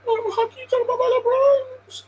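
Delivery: high, whiny fake-crying fan voice; mock a fan on the verge of tears over LeBron; mock emotional LeBron fan.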